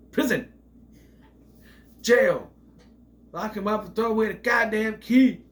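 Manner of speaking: loud, high indignant yelling; outraged shout. Real, not acted. Reviewer yelling in outrage at the film, loud and high. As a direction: demand he be locked up, shouting. Ranting about killer not jailed.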